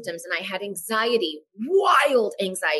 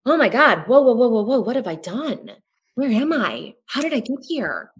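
louder, higher whoa of alarm; alarmed realisation; 3 semitones higher, 3 dB louder than usual. Retold or imitated. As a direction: catch yourself in shock. Sudden panicked realisation retold.

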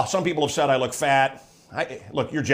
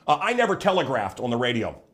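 ordinary talk, nothing marked

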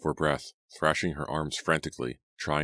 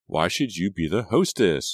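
angry demand; 3 semitones higher, 5 dB louder than usual. A narrator's reading. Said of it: "Julia demanded".

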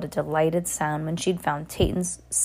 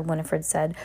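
plain reading voice

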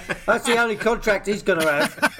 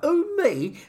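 much higher fake-innocent squeak; hammed-up "who, me" to the referee; mock innocence; 10 semitones higher than usual. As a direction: play the wide-eyed innocent to the ref. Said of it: "He looks at the ref like".

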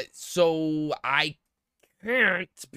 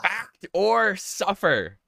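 game show host; 4 semitones higher, much slower than usual. Acted. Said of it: "host announces the game segment".

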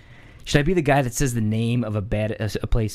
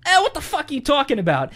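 annoyed local; 13 semitones higher than usual. Retold or imitated. Local dude arguing about cannoli.

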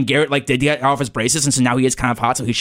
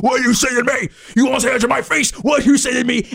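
rising, higher angry challenge; incensed Cooper; 11 semitones higher, faster than usual. Retold or imitated. Angry Cooper confronting Garrett at the lunch table. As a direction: demand it, getting hotter and higher. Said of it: "you know how Cooper gets".

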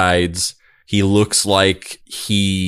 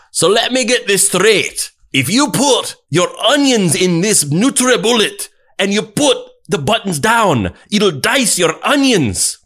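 put-on incredulous Scottish accent; 12 semitones higher than usual. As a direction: play an amazed Scotsman, higher. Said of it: "incredulous Scottish man".

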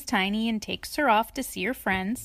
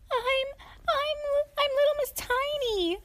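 very high, halting little voice; timid tiny character. A narrator's reading. Tiny to her rescuer, timid squeaky stammer.

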